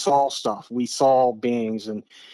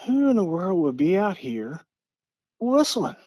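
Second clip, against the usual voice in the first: rising to a high, puzzled whistling?; startled question; 6 semitones higher than usual. Retold or imitated. Sister hears whistling in the woods, startled and puzzled. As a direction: sound baffled at noises in the woods.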